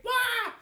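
high yelp of someone jumping; a short mock scream as you drop; falling scream. Preacher acting out a jump from a burning building, mock scream.

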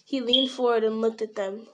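ordinary delivery

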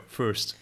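"first" with the R sounded, American style. Shown as a demonstration. Learner practising the better way, "first" with the R sounded. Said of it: "the better way".

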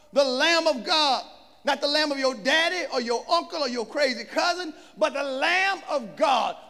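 loud, rising sermon climax; full-force preaching. Acted. Preacher proclaiming Jesus to the church. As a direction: drive it home to the congregation.

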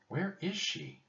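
high, hushed fretting; muttering. A narrator's reading. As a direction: wonder to yourself in a high voice. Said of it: "he muttered".

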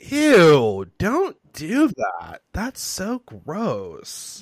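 loud "Ew", then a slow, drawn-out "don't do that"; loud, slow, disgusted self-scolding; disgusted recoil. Retold or imitated. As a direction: cringe hard at your own old clip, slowly. Recoiling at her old recording.

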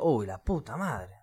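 soft, slow swearing under the breath; retold sinking dismay; dismayed curse. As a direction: curse quietly as it sinks in. Reacting to being spoiled.

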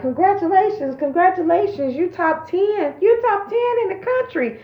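high, excited congratulations; excited quoted messages. Retold or imitated. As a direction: read out the texts in a gushing voice. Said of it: "text messages and GroupMe posts and emails like".

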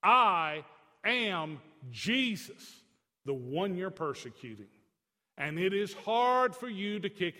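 slow commanding divine voice; voice of Jesus. Retold or imitated. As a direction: announce yourself with slow weight. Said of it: "So Jesus broke it down".

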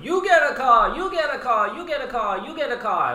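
high, loud giveaway host shout; Oprah Winfrey. Retold or imitated. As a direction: announce the prize like a talk show host. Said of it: "I feel like I'm on Oprah Winfrey".

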